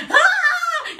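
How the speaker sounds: very high comic "ah"; laughing, imitated shriek; mock scream. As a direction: scream in pretend panic. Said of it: "we start going".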